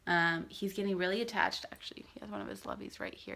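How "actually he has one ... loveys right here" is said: real whisper, fast and soft; hushed whisper. Real, not acted. Whispering while baby naps.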